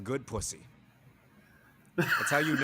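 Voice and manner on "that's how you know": laughing. Real, not acted. Punchline laughed out.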